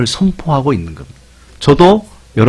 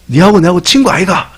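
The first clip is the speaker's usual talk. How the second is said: higher, broad Gyeongsang "친구 아이가"; Gyeongsang dialect; 5 semitones higher than usual. Retold or imitated. Preacher imitating a Gyeongsang friend, broad Gyeongsang accent. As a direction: play a blunt Gyeongsang buddy. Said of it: "이렇게 딱 하면은".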